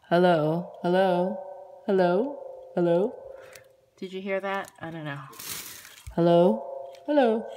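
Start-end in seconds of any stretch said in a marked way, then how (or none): 0.1-3.1 speaking into a toy echo mic; calling hello into echo mic; echo microphone. Shown as a demonstration. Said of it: "if you can hear how it sounds". 6.2-7.5 loud hellos into toy echo mic; echo microphone. Shown as a demonstration. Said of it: "So it does echo".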